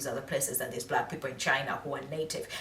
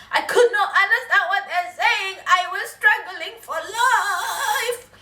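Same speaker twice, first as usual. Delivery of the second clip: high, laughing wail; comic laughing lament; 8 dB louder, slower than usual. Real, not acted. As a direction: laugh out the struggle, slow at the end. Laughing at her own confusion.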